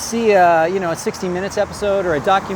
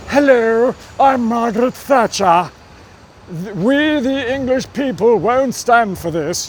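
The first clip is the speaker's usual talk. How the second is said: high, loud, hammed-up British accent; mock a grand British prime minister's accent; exaggerated British accent, pitched up and projected; Margaret Thatcher; 5 semitones higher, 4 dB louder than usual. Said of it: "putting on the accent".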